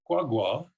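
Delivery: "kwa gwa", high tone then mid tone; high plus mid tone. Shown as a demonstration. Lecturer showing the tone pattern, "kwa gwa" high then mid. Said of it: "high plus midtone pattern".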